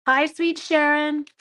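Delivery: high, slow, sugary hello; sweet greeting. Real, not acted. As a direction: greet her sweetly and slowly.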